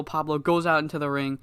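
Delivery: ordinary talk, nothing marked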